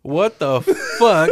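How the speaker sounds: slow, cracking up; can't believe it, laughing; real amused shock; laughing disbelief. Real, not acted. Listener reacts to the ostrich story.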